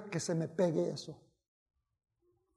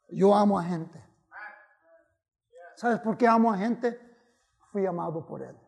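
ordinary talk

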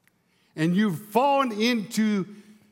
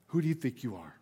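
quiet echo; 6 semitones lower, 8 dB quieter, faster than usual. Shown as a demonstration. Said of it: "It builds".

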